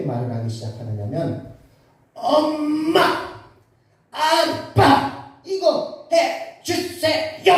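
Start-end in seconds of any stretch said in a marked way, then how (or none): 2.1-7.5 much higher, strained words sucked in on the breath; speaking on an inhaled breath. Retold or imitated. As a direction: speak while breathing in, like a stuttering child. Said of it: "숨을 들여 마시면서 말을 하기 시작하는". (숨을 들이마시며 높고 느리게 말하기)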